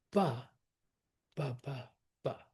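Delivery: first "ba" strong and set apart, then lighter beats; musical trochee rhythm. Shown as a demonstration. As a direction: lead hard on the opening "ba", then ease off. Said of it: "you get the musical".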